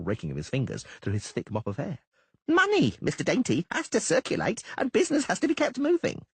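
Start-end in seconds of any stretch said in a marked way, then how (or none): 2.5-6.3 high, preachy halfling voice; smug halfling. Acted. Doppler lecturing the merchant on business, high and smug. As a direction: lecture him like a know-it-all.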